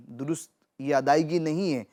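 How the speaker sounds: ordinary talk, nothing marked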